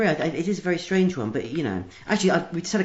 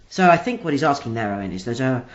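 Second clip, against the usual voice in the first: usual speaking voice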